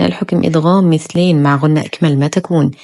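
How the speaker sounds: ordinary delivery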